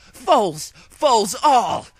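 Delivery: high, loud royal outburst; swore. A narrator's reading. Luna swearing at the frozen servants, high and loud. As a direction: bellow at the servants. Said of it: "Luna swore".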